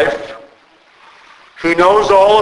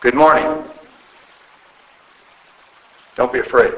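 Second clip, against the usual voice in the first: welcoming voice; 5 semitones lower, faster than usual. Shown as a demonstration. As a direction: welcome them without judgment. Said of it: "Do you hear the unconditional acceptance, welcoming".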